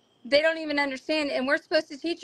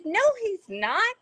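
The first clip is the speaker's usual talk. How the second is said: higher, slow denial; disbelieving denial; 6 semitones higher, slower than usual. Retold or imitated. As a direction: refuse to believe it, slow and raised. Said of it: "And I said".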